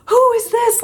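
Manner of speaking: sudden high startled cry; a mock panicked squeal at a stranger; freaked-out shriek. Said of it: "she kind of freaks out".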